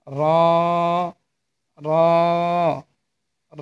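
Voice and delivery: "র" thick, tongue tip to the ridge, vowel held; slightly heavy letter. Shown as a demonstration. Teacher repeats the letter for learners, "র" held and slightly heavy. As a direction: lift the tongue tip and draw "র" out heavily. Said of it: "হরফটি একটু মোচা-, মোটা হবে উচ্চারণ".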